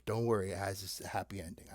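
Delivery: soft whisper; whispered aside. Real, not acted. Reassuring the children in a soft whisper. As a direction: whisper it like a secret.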